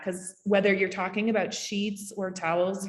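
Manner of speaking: ordinary talk, nothing marked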